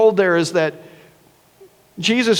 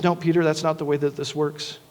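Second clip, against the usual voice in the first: softer, lower, a tender correction; very gently; 6 semitones lower, 9 dB quieter than usual. Retold or imitated. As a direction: correct him softly, like a patient teacher. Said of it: "Jesus very gently says".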